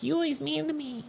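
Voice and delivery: very high squeaky mimicry; mocking whiny girl. Girl complaining he's mean to her, mocking high whine.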